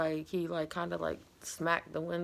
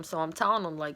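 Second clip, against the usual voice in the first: ordinary delivery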